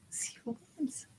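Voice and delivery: breathy suspense whisper. Real, not acted. Building suspense for giveaway.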